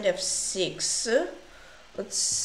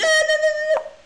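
dismayed cry; 10 dB louder, faster than usual. Real, not acted. Blunder seen in chess game.

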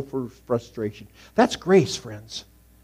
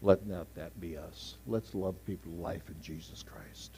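whispered, intimate; whispered plea; 8 semitones lower, 16 dB quieter than usual. Real, not acted. Pastor urging his church to love, in a whisper. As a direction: breathe it to the congregation.

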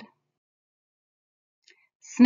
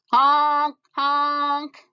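loud, higher honk honk; honking noise; 7 semitones higher, 8 dB louder than usual. Acted. Snail honking as he dodges the crow, loud high honks. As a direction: honk like a horn to scare off the crow.

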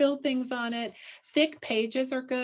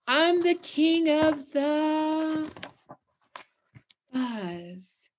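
drawn-out boast; 5 semitones higher, much slower than usual. Shown as a demonstration. Teacher repeating the boast slowly for the class, drawn out. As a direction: stretch it out, then let fuzz sink. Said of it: "Did they hear me?".

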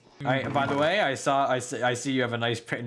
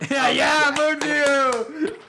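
high, loud excited shout; excited squeal; 14 semitones higher, 7 dB louder, much slower than usual. Real, not acted. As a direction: squeal the name with glee. Cheering the name.

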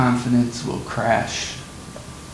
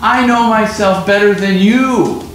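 higher, louder offended retort; indignant boast; 7 semitones higher, 6 dB louder than usual. Retold or imitated. Peter taking it as an insult from Jesus, indignant and loud. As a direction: throw the boast back at him. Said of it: "That's what he's saying to Jesus".

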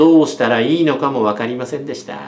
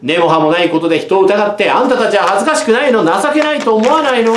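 rising, much higher reproach; girl shaming the bullies; indignant scolding; 6 semitones higher, 3 dB louder than usual. A narrator's reading. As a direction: shame them to their faces. Fumiko scolds the boys.

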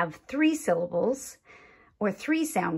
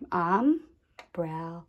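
"Umbrella" slowly, with pauses between syllables; one sound group at a time; 3 semitones lower than usual. Shown as a demonstration. Teacher laying down crayons, "Umbrella" in isolated syllables. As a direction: set um, brel, la apart in "Umbrella". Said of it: "Now for our crayons".

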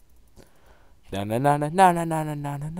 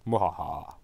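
evil laugh; 9 semitones lower, 8 dB quieter than usual. Acted. Evil laugh again, low.

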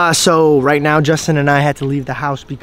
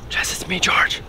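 whisper; 3 semitones higher, 6 dB quieter than usual. Real, not acted. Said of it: "sneaking in at night".

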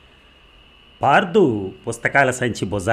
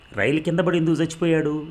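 put-on higher, wide-eyed child's question; innocent child; 8 semitones higher than usual. A narrator's reading. Parthu asks his friends innocently, a child's puzzled voice. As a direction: ask it like a puzzled little boy. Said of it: "Partha అమాయకంగా అడిగాడు".

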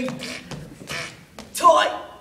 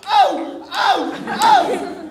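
loud higher grunts of strain; effort grunts; 4 semitones higher, 5 dB louder, much slower than usual. Acted. Convict digging a hole, grunting. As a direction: grunt with each dig.

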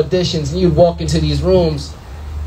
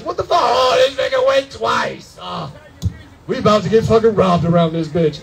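mock panic; 2 semitones higher than usual. Panicked buyer on the block.

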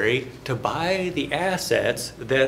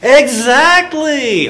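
excited praise; 12 semitones higher, 13 dB louder, much slower than usual. Real, not acted. Student gives the right answer.

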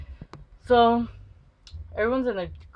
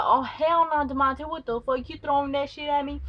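angry confrontation; 7 semitones higher than usual. Retold or imitated. Girl confronts Demonte.

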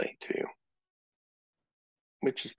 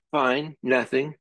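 drawn-out, higher sulky fine; sulky brush-off; 6 semitones higher, slower than usual. Retold or imitated. Withdrawn wife brushing off questions, slow sulky reply. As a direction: shut them out with a slow sulk. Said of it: "they're gonna go".

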